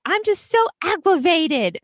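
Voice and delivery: put-on high toddler voice, way up, dramatic sigh; imitating her three-year-old. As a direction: play a little girl declaring she's so aggravated. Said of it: "my little three-year-old will be like".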